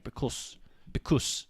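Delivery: "Because" with an emphatic unvoiced S ending; hard final S. Shown as a demonstration. Demonstrating the accent, "because" with hard S. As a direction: end each "because" on a long, sharp S. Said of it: "they really, really pronounce the S on the end".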